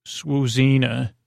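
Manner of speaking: ordinary delivery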